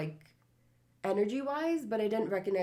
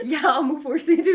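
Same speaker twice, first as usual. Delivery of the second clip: loud, high words through laughter; laughing; 8 semitones higher, 12 dB louder than usual. Real, not acted. Sister recalls trying a fish stick, laughing. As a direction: tell it while cracking up.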